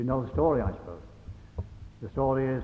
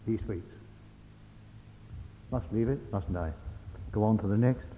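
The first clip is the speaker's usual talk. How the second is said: ordinary talk, nothing marked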